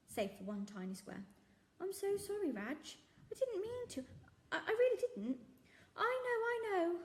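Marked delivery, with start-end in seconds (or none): 1.8-5.4 high, pleading apology; apologetic girl. Acted. Girl apologising to the shopkeeper for eating the bar, high and pleading. 6.0-7.0 kindly reassurance. Acted. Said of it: "said the kindly man".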